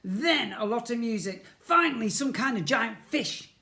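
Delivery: loud, high sister voice; bossy big sister. A narrator's reading. Big sister accusing Ned through the door, loud and high.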